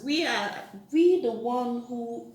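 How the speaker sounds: ordinary talk, nothing marked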